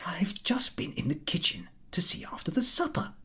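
high, hushed, eager; nervous confiding. A narrator's reading. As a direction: confide to the Frenchman. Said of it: "he said to the Frenchman".

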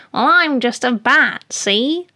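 high, loud little bat voice. Acted. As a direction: introduce yourself as a perky little bat. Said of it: "And he goes".

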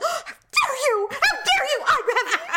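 very high, loud, theatrical indignation; mock outrage. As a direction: act scandalized, hammed-up and breaking toward laughter. Hypothetical offended comeback.

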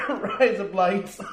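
high, slow go at Australian; attempted Australian accent. Shown as a demonstration. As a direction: stretch it out in a try at an Australian accent. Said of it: "trying to say it in an Australian accent".

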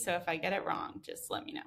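normal talking voice

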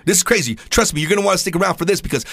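ordinary talk

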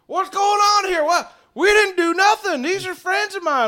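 very high, loud panicked cries; alarmed protest. Acted. Claude seeing friends seized by vines, alarmed shouting.

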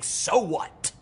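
hint of a defiant, laughing villain; lightly acted maniacal taunt with forced ha-ha laughter; the Joker laughing. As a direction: throw out a mock villain cackle mid-taunt. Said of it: "It's like the Joker in that final scene".

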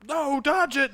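high mock-panicked cry; urgent warning. Everyone watching warns Piccolo to dodge, urgent warning. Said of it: "everyone's like".

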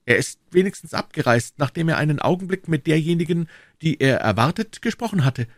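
put-on high-pitched, keen young informant; eager young D'Artagnan, reporting. Voices young D'Artagnan in dialogue. (eifrig berichtender junger Mann, hohe Stimme)

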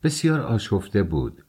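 ordinary delivery, nothing marked

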